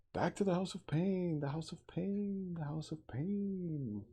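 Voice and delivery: high, slowing chant of an ape creature; a high, sing-song beast-man chant; ape man yelp. Acted. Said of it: "yelped the voice of the ape man".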